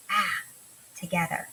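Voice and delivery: usual speaking voice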